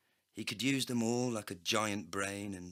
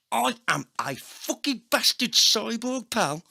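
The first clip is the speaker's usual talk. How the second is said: loud, high ranting cyborg voice; voiced cyborg agent; 9 semitones higher, 8 dB louder than usual. A narrator's reading. Cyborg agent ranting at the narrator, loud and high. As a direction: bark it like a crude robotic thug.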